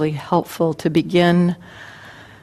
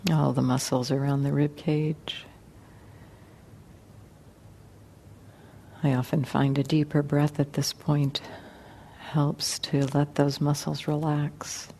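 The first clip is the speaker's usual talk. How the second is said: guided meditation; 3 semitones lower, 8 dB quieter than usual. Acted. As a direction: ease them gently into relaxation, unhurried and quiet.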